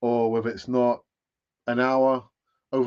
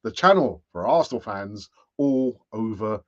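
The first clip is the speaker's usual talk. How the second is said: show sign-off; 7 dB louder, slower than usual. Real, not acted. Host closing the channel's show for Arsenal fans, announcer sign-off.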